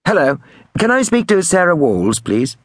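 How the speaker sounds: high-pitched prank-call voice; prank caller. A narrator's reading. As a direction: ask innocently in a high put-on voice. Prank caller poses as innocent caller.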